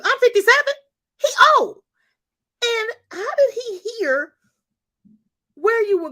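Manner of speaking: high, loud, voice cracking into a cry; blurting it out on the edge of crying; near tears. Real, not acted. Tears welling over daughter's ride.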